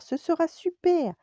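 high, loud, enthusiastic coaxing; eager insistence. A narrator's reading. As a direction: sell the idea with big storybook excitement. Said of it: "insiste Clochard". (insistant et enthousiaste, voix aiguë)

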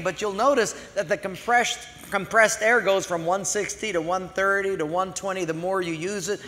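ordinary talk

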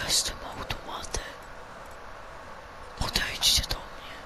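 mysterious unseen voice whispering; very soft, voiceless whisper. Pretend. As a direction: whisper it like a hidden spirit voice. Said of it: "Jakby ktoś szeptał".

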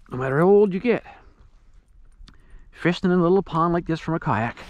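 usual speaking voice

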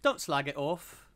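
slow, put-on British slang delivery; mock British accent.

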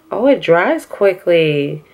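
normal talking voice

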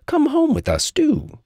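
high, eager welcome; sweet high-voiced storybook host; kind hare and hedgehog. A narrator's reading. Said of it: "said the kind hare and hedgehog".

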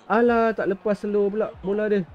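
ordinary talk

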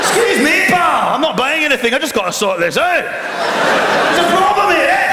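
put-on loud, pushy Scottish accent; Scottish confrontation. Scottish shopper butting into the queue, loud pushy Scottish accent. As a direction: barge in like an indignant Scot.

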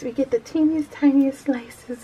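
put-on little girl. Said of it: "I, I just".

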